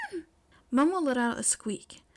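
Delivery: usual speaking voice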